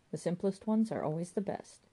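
ordinary delivery

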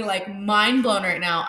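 ordinary talk, nothing marked

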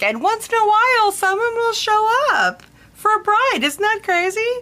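high-pitched, light, tentative sing-out; gentle awkward lead-in. Retold or imitated. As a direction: ease into odd news with a high, nervous-laugh lightness. Breaking odd news gently to bride.

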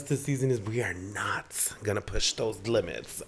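whispering. Real, not acted. Whispered aside.

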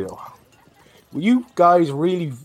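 fed-up woman waving off pushy guards; annoyed retort. Acted. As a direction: drag it out like you're fed up being hassled. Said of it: "And I say".

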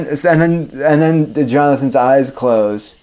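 normal talking voice

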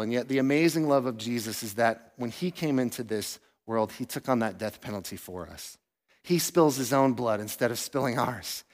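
soft, low and reverent; preacher softly telling of the cross; tender. Real, not acted. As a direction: hush it, full of awe. Tender climax of gospel.

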